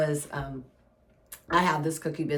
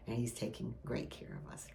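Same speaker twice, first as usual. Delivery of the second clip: whisper; 5 semitones lower, 14 dB quieter than usual. Real, not acted. Closing sermon line.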